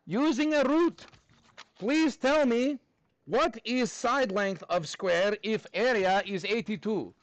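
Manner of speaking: slow put-on Russian accent. Teacher handing class a problem, slow mock Russian accent. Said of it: "do this in a Russian accent".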